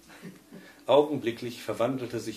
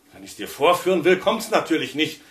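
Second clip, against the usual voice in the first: louder, sharp frustrated snap; disappointed snarl; 5 semitones higher, 9 dB louder than usual. A narrator's reading. Husband snaps at his wife when the crackling fails, frustrated. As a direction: spit it out, thwarted. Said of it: "fauchte der enttäuschte Felix".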